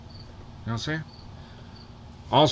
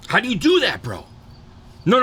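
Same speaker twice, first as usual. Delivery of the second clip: ordinary talk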